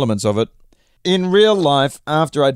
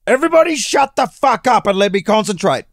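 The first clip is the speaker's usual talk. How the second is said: screaming; 9 semitones higher, 3 dB louder than usual. A narrator's reading. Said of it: "Provence screamed".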